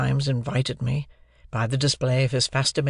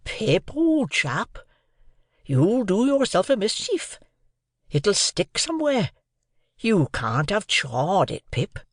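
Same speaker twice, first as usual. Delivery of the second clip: higher, earnest Joe pleading; worried, earnest remonstrance; serious remonstrance; 7 semitones higher, slower than usual. A narrator's reading. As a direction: warn the boy gravely, as Joe. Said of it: "in very serious remonstrance".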